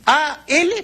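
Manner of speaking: "A, L" spelled out high, each letter apart. Shown as a demonstration. Teacher spelling the abbreviation et al for viewers, letter by letter. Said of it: "el ali acortado".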